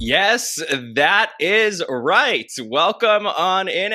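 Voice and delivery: high, loud, drawn-out welcome; showy host intro. Real, not acted. Host opening the podcast for listeners, big excited intro. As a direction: belt out the opening like an announcer.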